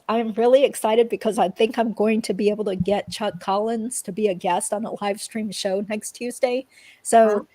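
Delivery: ordinary talk, nothing marked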